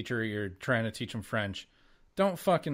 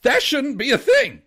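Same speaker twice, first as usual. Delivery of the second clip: much higher and louder indignant retort; 14 semitones higher, 14 dB louder than usual. Retold or imitated. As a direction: fire it back in loud disbelief. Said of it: "And I said".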